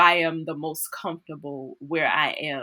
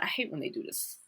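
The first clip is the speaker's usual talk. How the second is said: whispered aside; 2 semitones lower, 13 dB quieter than usual. Retold or imitated. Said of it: "She said".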